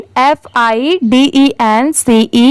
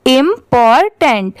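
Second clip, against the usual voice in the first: syllable by syllable. Shown as a demonstration. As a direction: sound out important as im, por, tent. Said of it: "इन तीनों को मिला देंगे". (Important को धीरे-धीरे, टुकड़ों में)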